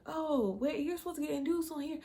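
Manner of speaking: high-pitched voice of dawning realisation; mimicked surprised staff. Hospital staff finding she is booked for induction, mimicked surprise.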